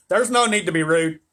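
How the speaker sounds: coldly. A narrator's reading. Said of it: "she said coldly".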